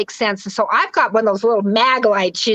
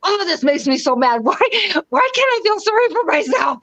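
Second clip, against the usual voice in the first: mock exasperated wail through laughter, jumping higher; laughing while mad; 6 semitones higher than usual. Woman retelling laughing because she was crying, comically exasperated. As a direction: laugh at your own frustration, acting it out. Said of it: "I'm laughing because I was crying".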